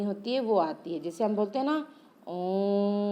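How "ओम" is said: "ओम" chanted and held long. Shown as a demonstration. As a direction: draw out the ओम like a chant. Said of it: "लंबा ले रहे हैं ना". (लंबा खींचा हुआ "ओम")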